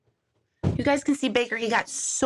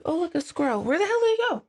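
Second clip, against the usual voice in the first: mock distracted; 5 semitones higher, faster than usual.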